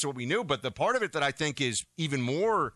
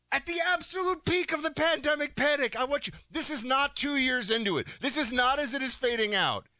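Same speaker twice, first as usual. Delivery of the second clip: heated rant; 8 semitones higher than usual. Real, not acted. Host ranting about pandemic lockdowns.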